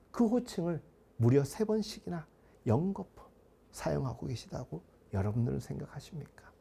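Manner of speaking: usual speaking voice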